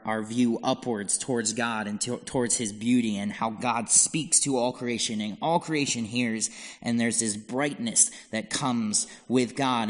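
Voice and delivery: normal talking voice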